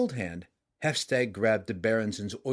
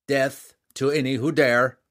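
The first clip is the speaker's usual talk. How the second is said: loud fierce vow; 3 semitones higher, 7 dB louder than usual. A narrator's reading. Rival king vows.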